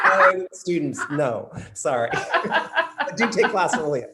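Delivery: loud, high and laughing; laughing apology. Real, not acted. Coach correcting a slip to students, laughing. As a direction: say it through real laughter.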